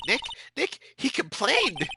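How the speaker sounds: high, excited tattling; giddy high-pitched astonishment; amazed glee. Acted. Character excitedly reports the detective complaining.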